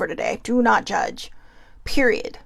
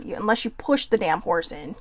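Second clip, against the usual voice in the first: normal talking voice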